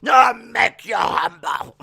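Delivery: loud, high, slow bellow; roaring wrestler threat. Acted. Veer attacking Dominic, slow acted roar.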